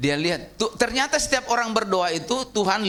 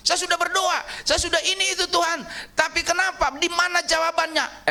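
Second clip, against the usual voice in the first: high-pitched whining complaint to God; mock the impatient believer's grumbling; complaining prayer; 10 semitones higher than usual. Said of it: "seolah-olah mengatakan begini". (keluhan merengek dengan nada tinggi)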